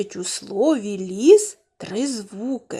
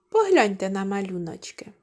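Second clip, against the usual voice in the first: ordinary talk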